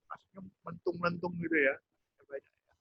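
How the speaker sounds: usual speaking voice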